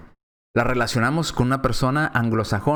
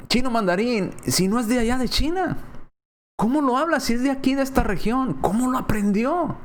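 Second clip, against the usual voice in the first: amazed hearers; 9 semitones higher than usual. Acted.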